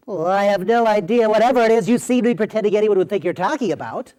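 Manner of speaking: high, funny character voice; Bobby Brodenheimer. Acted. Host answering his friend as a silly character, funny voice. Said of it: "this funny Bobby Brodenheimer voice".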